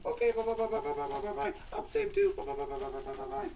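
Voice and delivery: very high squeaky doll voice; high-pitched puppet Susie Q; squeaky girl puppet. Acted. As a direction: play a tiny girly doll. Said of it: "Susie Q".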